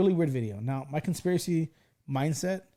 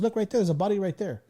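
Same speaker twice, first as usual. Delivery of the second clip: ordinary talk, nothing marked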